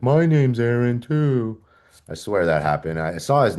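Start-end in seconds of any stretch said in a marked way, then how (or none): 0.0-1.6 slow, higher, eerie strange voice; creepy patient. Retold or imitated. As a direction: stare him down and say it oddly. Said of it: "he's looking at me like this".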